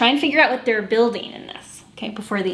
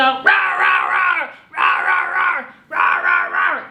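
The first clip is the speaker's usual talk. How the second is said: playful tiger roar; 7 semitones higher than usual. Acted. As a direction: roar like a friendly cartoon tiger. Tigers roaring in song.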